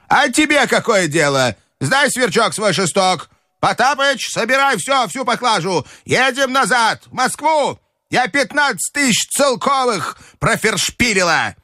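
put-on very high old-lady voice, bossy; irascible grandmother. A narrator's reading. Grandmother scolding servants and ordering packing, high and cross.

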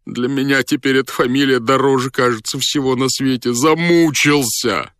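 exasperated, high plaintive voice; tormented lament. A narrator's reading. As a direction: moan like a worn-out sufferer.

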